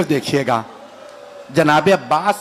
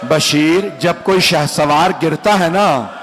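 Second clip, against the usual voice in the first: ordinary talk, nothing marked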